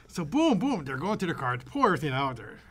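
ordinary talk, nothing marked

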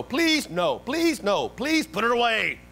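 put-on high-pitched kid's plea; mock begging, high pitch on each please. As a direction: act out a child nagging a parent. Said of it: "your kids to Walmart and they're like".

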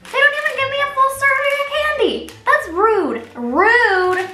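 high, loud mock outrage; indignant. Real, not acted. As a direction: complain it's rude. Complains about half serving.

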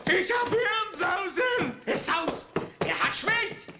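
drawn out, slow, high howl; slow anguished shout. Acted. Father crying that the buzzing has seized him, slow anguished shout. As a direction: stretch every word like madness is grabbing you.

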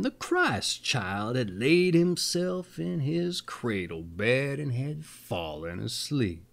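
plain reading voice

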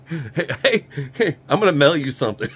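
playful pretend shout-out to a friend; mock eager call. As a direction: hail a buddy playfully, a bit fast.

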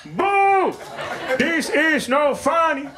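boo slowly and high like a foreign uncle in the crowd; heckling uncle. Retold or imitated. Uncle heckling his nephew's set from the front, high accented jeer. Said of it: "why the fuck is my uncle heckling me".

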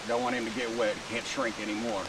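usual speaking voice

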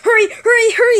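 high, loud, urgent chant; excited high-pitched hurrying; put-on toy voice. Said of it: "This is your voice".

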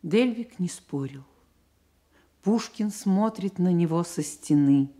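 normal talking voice

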